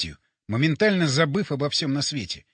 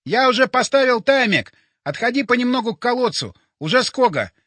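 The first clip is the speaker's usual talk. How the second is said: high, loud urgent reply; shouted reply; 7 semitones higher, 4 dB louder than usual. A narrator's reading. Borya to narrator while setting the timer, shouted reply. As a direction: call it back hurriedly.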